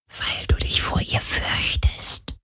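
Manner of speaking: flüsternd. A narrator's reading. Geist flüstert die Wahrheit.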